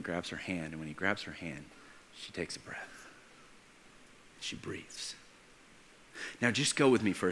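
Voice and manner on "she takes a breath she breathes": near-whispered, suspenseful reveal; awed hush. A narrator's reading. Preacher telling of the dead girl reviving, awed hush. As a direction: let it out barely above a whisper. Hushed climax of the miracle.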